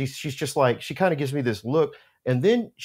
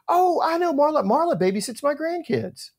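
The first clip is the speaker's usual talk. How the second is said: put-on much higher, sweet little old lady; 14 semitones higher than usual. The owner cheerfully explains she knows Marla, high and sweet. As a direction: play the proud silver-haired grandma.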